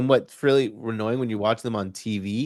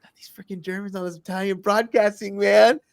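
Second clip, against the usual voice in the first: mock annoyance; 9 semitones higher than usual. Griping about German commentators, mock exasperated. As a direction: complain in a pitched-up, fed-up voice. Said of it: "I'm like".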